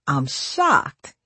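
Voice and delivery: teasing mock shock; joking. A narrator's reading. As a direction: tease her with fake surprise. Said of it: "he joked".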